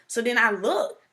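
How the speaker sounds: normal talking voice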